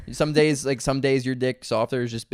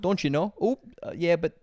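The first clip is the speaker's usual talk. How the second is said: put-on higher-pitched Fargo-style Minnesota accent; 3 semitones higher than usual. Host doing the accent from Fargo, exaggerated Minnesota accent. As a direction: overdo the Minnesotan lilt. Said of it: "what do they say?".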